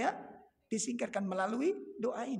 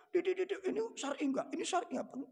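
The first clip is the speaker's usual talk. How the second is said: mock husband; 10 semitones higher, faster than usual. Acting a husband questioning wife.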